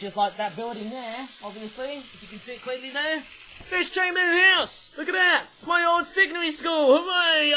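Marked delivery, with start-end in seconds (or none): 3.7-7.5 high, loud delighted cry; excited shout. Real, not acted. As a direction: shout it out, thrilled to spot it. Spots his old school.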